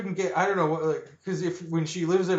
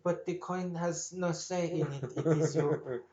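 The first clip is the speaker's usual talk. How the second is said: put-on a slow, heavy Israeli accent on a film line; imitating Gal Gadot's Israeli accent on a movie quote; mock Israeli accent as Carla Jean; 2 semitones higher, 6 dB quieter, slower than usual. Friend mocks the actress doing the film line in an Israeli accent. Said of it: "Her trying to play the wife from No Country".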